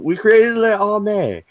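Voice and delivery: high-pitched put-on French accent. EU boasting to Britain, in a high mock French accent. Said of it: "That's my best French accent".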